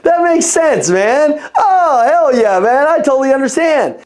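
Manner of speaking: hammed-up enthusiastic listening; eager listener. Shown as a demonstration. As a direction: show you're hanging on every word. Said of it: "I gotta be like this".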